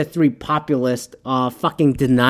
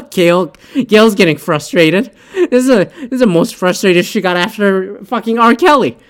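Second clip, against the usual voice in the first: higher, louder amazed riff; amused outburst; 5 semitones higher, 4 dB louder than usual. Real, not acted. Speaker reacts to Gayle King getting mad, amused outburst. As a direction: let the voice leap up in amused disbelief. Riffing on Gayle King's frustration.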